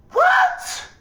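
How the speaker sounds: a very high, loud yelp of disbelief; incredulous what. Real, not acted. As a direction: shriek it like you can't believe it. Incredulous outburst.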